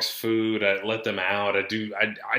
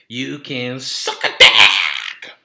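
slow build into a loud bellow; drawn-out, loud mock-angry send-off; mock insult; 8 dB louder, much slower than usual. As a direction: stretch it out, then blast the last words. Mock insult at younger listeners.

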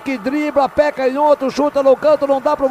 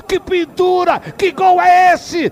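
higher, loud goal scream; explosive goal commentary; 6 semitones higher, 6 dB louder than usual. Real, not acted. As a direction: erupt with the goal, hold gol long. Narrator calls Grêmio goal.

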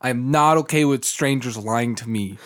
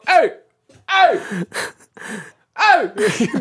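calling out; 10 semitones higher, much slower than usual. Retold or imitated. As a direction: call out to the car beside you. Calling out car window.

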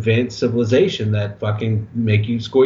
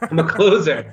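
mock Baldwin; 5 semitones higher than usual. Echoing the Baldwin speech.

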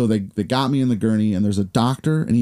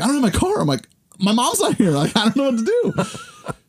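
put-on panicky kid. Panicked kid quoting himself.

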